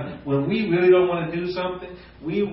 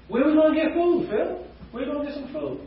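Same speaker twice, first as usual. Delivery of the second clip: much higher, anxious mock disciple; fretful Philip; 11 semitones higher, faster than usual. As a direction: play the worried disciple fussing about food. Philip's worried question voiced.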